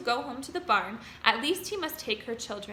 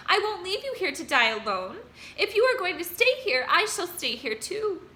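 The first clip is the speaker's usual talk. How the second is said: high, loud pleading; 7 semitones higher, 4 dB louder than usual. A narrator's reading. Wilbur vowing to stay with Charlotte, high and loud. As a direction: insist he won't leave.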